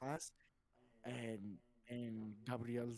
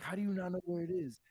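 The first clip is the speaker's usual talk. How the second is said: higher, louder exasperated question; exasperated demand; 7 semitones higher, 6 dB louder than usual. Retold or imitated. Principal questioning the student, in mild mock exasperation. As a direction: push it at him in disbelief. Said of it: "He's like".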